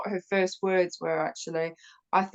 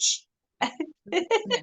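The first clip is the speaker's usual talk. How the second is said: sharp imitated "shh"; hissed shush for silence; 11 semitones higher than usual. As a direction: act out hushing a partner. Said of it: "I'm like this".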